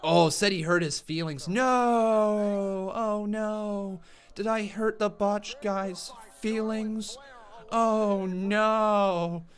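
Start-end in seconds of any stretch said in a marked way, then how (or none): 1.5-9.5 drawn-out mock wail, building louder; mock dismay. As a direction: wail a hammed-up lament, stretching every vowel. Mock concern over hurt feelings.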